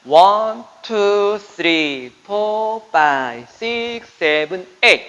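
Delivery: rhythmic dance count. Shown as a demonstration. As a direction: call the beats out crisply. Dance teacher counting beats.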